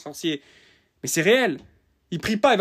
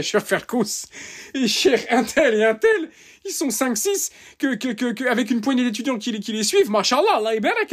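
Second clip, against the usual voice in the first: scoffing; 7 semitones higher than usual. Acted. Scoffing at few scholars.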